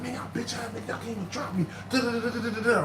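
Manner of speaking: high, softened mock rant; retold trash talk. Thrown-out man ranting at bouncers, mock trash talk. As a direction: replay an angry rant in a small high voice. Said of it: "I'm talking shit".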